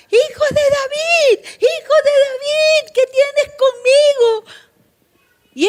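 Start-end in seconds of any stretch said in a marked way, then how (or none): 0.1-4.4 crazed shout. Retold or imitated. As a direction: scream it out like a madman. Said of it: "Este hombre que parecía un loco gritaba".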